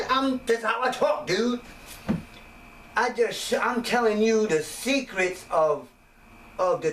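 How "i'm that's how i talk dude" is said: defending himself. Retold or imitated. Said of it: "I'm like".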